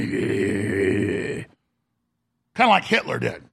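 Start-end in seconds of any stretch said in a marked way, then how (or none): 0.0-1.4 low, drawn-out menacing noise; mock sinister entrance noise; menacing walk-in growl. Mocking a spy chief entering rooms, menacing. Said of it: "he'd walk into rooms going".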